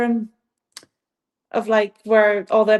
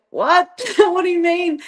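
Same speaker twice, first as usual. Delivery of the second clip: surprised; 8 semitones higher than usual. Retold or imitated. Retold shock at border control.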